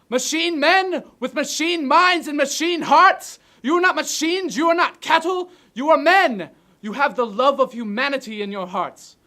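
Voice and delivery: high, loud, impassioned shouting; ranting dictator speech. Acted. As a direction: rouse the soldiers with full force. Rehearses climax of speech.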